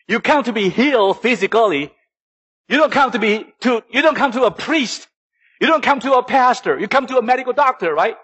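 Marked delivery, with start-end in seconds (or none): none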